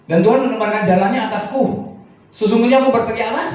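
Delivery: loud, high, forceful reading of Job's words; preaching Job's complaint. Acted. Preacher voicing Job's protest to the congregation, loud and high.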